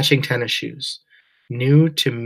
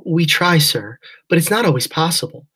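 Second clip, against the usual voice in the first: apologetic agent; 6 semitones higher, 5 dB louder than usual. A narrator's reading. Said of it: "sounding truly sorry".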